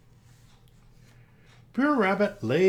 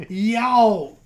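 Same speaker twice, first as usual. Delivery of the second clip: high, loud yelp of pain; 9 semitones higher, 10 dB louder than usual. Acted. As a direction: yell it like a bee just stung you. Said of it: "cried Bre'r Bear".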